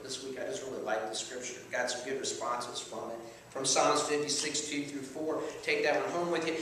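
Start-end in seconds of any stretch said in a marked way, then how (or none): none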